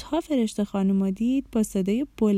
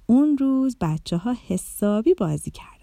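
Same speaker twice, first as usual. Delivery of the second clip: ordinary delivery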